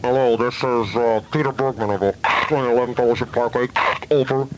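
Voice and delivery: talking through a crackly car radio; radio call with static bursts. Acted. Caller phones the aviation authority from his car, car radio voice. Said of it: "I called them like this".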